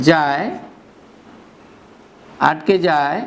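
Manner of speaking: word by word with long pauses; slow dictation. Shown as a demonstration. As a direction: dictate slowly for notes. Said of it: "teacher dictating definition". (ধীরে ধীরে লেখানো)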